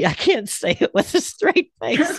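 laughing through the words; cracking up while talking. Real, not acted. Breaks up laughing mid-read.

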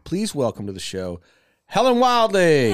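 loud, drawn-out guest welcome; host introduction. Real, not acted. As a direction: announce her name with a big build. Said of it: "introducing the guest".

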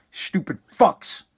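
ordinary talk

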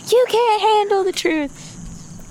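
high, slow, intense courtroom movie line; Tom Cruise. Retold or imitated. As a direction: play up a dramatic film outburst. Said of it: "like A Few Good Men with Tom Cruise".

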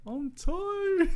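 high, loud whiny voice; mock whine. Playing Andre, whiny excuse.

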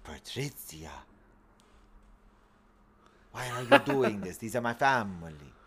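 bad Italian accent. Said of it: "I will do impersonations of it".

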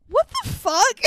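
high, baffled outburst; exasperated disbelief. Real, not acted. Host baffled by the movie's couple, high exasperated outburst. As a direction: pitch it up in sheer disbelief.

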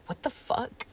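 whispered what the fuck. Retold or imitated. Retold reaction when he's absent.

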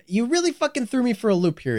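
usual speaking voice

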